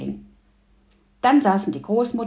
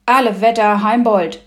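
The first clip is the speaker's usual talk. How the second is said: loud, exclaiming rebuke; loud, exasperated scolding; exasperated outburst; 2 semitones higher, 9 dB louder than usual. A narrator's reading. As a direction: burst out at a stubborn father. Girl scolds her stubborn father.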